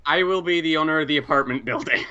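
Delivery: normal talking voice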